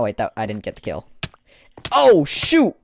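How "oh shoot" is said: sudden high startled yell; shocked shout. Real, not acted. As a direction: blurt it out in shock.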